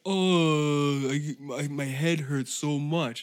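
whiny hungover moan, drawn out; hammed-up hangover complaint; mock hungover whine. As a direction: groan it like a sorry drinker.

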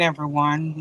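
ordinary talk, nothing marked

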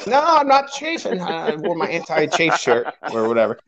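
put-on high-pitched denial; defensive denial. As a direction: act out an indignant no. Retelling runner's denial of chafing.

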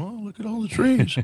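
high-pitched mocking voice of wonder; Biden. Host imagines the president wandering lost in the forest, mocking wide-eyed wonder. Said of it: "he's probably somewhere out in the forest going".

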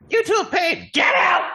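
high, loud gnome yell; piercing yell from a small, bossy hostess; bossy gnome shout. Acted. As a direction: order them out in a high shout. Gnome orders customers out.

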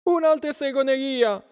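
very high-pitched mob outcry; shouting villagers. A narrator's reading. Villagers crying witchcraft, shouting. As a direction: cry out as an alarmed crowd, high. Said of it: "gridarono i contadini".

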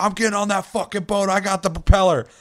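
high, loud imitation of a player yelling over everyone; mock yelling. Player claiming his seat on the boat, yelling over everyone. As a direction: shout it over the whole radio chatter. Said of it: "that guy's just yelling over everyone".

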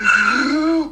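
high, mock-horrified gasp; shocked gasp. Dad acting out the moment he saw "blood", shocked gasp. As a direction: suck in a sharp high breath. Acts out his shock at sight.

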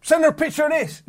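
hammed-up giddy suggestion; mock excited. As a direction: pitch it up like an overexcited mate. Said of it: "Dave's mate just gets so excited".

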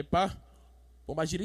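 usual speaking voice

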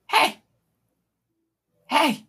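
high, tiny squeaky call. Acted. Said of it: "tiny, squeaky voice".

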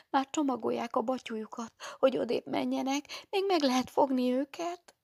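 put-on high, eager girl's voice; young candidate confiding to the nun in a high voice; timid young girl. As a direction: tell the secret breathlessly. Young candidate tells what she heard.

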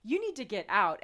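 rising high, outraged disbelief; retold indignant outburst. Confronting a rude customer in the shop, incredulous and outraged. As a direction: replay the shock at a rude customer, voice climbing. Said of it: "I'm like".

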